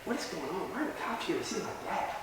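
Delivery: very soft, hushed questions to oneself; worried self-talk. Retold or imitated. Teenager walking back to parents' room, hushed and worried. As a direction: act out bewildered thinking under the breath.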